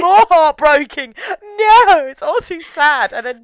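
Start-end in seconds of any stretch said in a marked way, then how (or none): 1.6-3.1 tearful. Real, not acted. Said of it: "I'm nearly welling up now".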